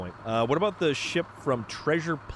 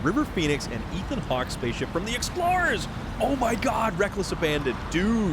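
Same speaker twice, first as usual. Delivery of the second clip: higher-pitched geeky delight; real excitement; 8 semitones higher than usual. Real, not acted. Fan recognising the spaceship from the Explorers, excited outburst. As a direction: burst out as the memory hits you. Thrilled by a remembered film ship.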